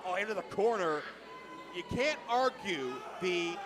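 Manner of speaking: ordinary talk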